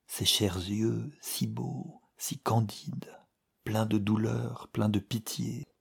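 soft, near-whispered reading; hushed narration. A narrator's reading. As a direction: breathe the words as if not to wake her. Tender narration of her eyes.

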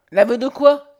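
loud, high, exasperated question; exasperated cry. A narrator's reading. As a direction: throw the question back, exasperated. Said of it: "S'écria Nathalie exaspérée".

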